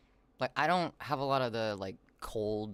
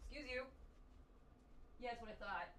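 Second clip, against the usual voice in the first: pet scolding voice; 12 semitones higher, 15 dB quieter, faster than usual. Pretend.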